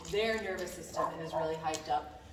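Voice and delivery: usual speaking voice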